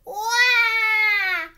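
put-on high, loud baby wail; toddler wail. Trixie bawls in the street, loud high wail. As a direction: bawl it like a toddler losing it. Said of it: "Trixie bawled".

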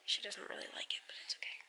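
barely voiced whisper, trailing away; hushed whisper. Real, not acted. Mom near her sleepy baby, whispering.

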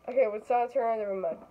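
normal talking voice

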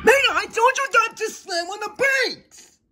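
high, exasperated yell; furious high-pitched outburst; angry shout. Acted. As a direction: scold at the top of the pitch range. Shouting after another hard stop.

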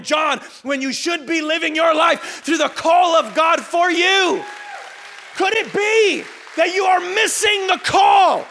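loud, high, driving sermon shout; impassioned preaching. Real, not acted. Preacher challenging the congregation about comparison, impassioned. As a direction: push each question out at full force.

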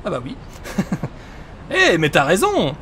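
normal talking voice

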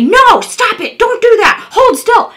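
high-pitched scolding shout; demonstrated yelling. Shown as a demonstration. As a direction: yell sharply as if scolding a puppy. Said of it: "don't scream at them, don't yell at them".

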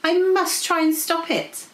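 high, urgent dragon voice; horrified dragon. A narrator's reading. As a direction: resolve it in a panic. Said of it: "Goldie looked horrified".

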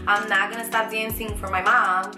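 ordinary delivery

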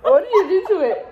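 laughing while asking. Real, not acted. Repeating question while laughing.

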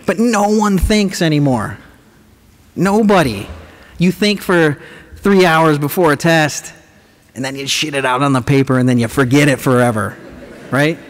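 ordinary talk

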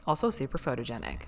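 normal talking voice

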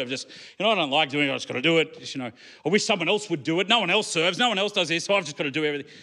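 low, muttered whiny complaining; mock grumbling. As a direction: play a put-upon believer moaning about chores.

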